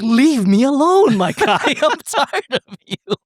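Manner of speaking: high, slow, exaggerated weary complaint; mock fed-up groan. Teller to the passing Pope, slow hammed-up weariness. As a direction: drag it out like you're sick of him.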